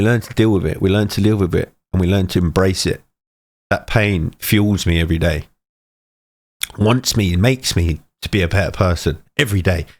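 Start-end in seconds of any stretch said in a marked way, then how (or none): none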